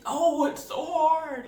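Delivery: high, slow, hammed-up wail of suffering; mock agony. Vlogger mocking the trailer man's agony, high slow wail. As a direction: overdo the man's pained groan. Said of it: "screaming out in agony as if".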